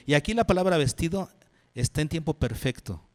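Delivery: normal talking voice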